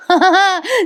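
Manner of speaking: short high chuckle; quick, high giggle at the mix-up; amused laugh. Real, not acted. Narrator clearing up the wine misunderstanding, amused laugh.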